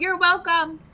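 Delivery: mild mock shout, pitched high; retold shout. Calling after someone who walked through the held door, mock shout. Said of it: "I just shout".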